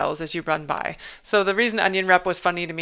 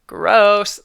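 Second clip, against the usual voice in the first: disgusted exclamation; 3 semitones higher, 11 dB louder than usual. Acted. Reacting in character.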